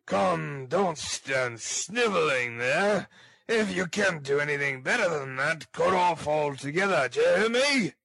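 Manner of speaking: angry ruffian building to a loud shout; angry bully. Acted. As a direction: scold harshly, rising high on the last question. Sikes angrily scolds the girl.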